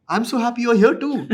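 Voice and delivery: high, loud, gushing reply; hammed-up excited squeal of a reply; wired, over-eager. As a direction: imitate yourself returning a compliment far too eagerly. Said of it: "I was so wired".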